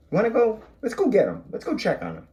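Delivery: high, eager invitation; rally the kids to go find him; cheerful call to go get him; playful excitement. Pretend. Coaxing kids to search.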